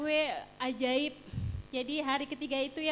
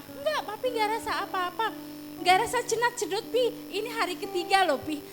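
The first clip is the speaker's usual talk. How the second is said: quick, higher retold back-and-forth; eager insistence; 6 semitones higher, faster than usual. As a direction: rush the words out in disbelief. Husband and wife retold exchange.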